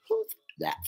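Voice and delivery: squeaky voice breaking into a laugh; laughing squeak. Retold or imitated. Still mimicking her reply, now laughing. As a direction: giggle through the words in a high squeak. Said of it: "I was just being like".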